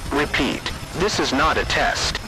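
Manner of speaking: usual speaking voice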